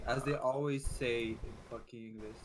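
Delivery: ordinary talk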